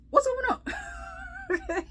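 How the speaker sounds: high, slow, drawn-out laugh of disbelief. Retold or imitated. Retelling finding strangers in her seats, laughing in disbelief. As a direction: stretch it out through a laugh, baffled.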